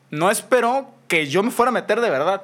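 ordinary talk, nothing marked

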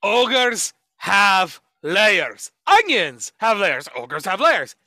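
loud, emphatic ogre declaration; slow opening, each word hit hard; Shrek. Acted. Quoting the ogre line to a podcast co-host, hammed-up Shrek. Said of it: "Fucking Shrek".

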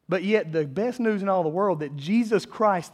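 ordinary delivery

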